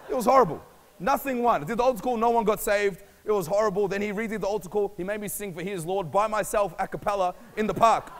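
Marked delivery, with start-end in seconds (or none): none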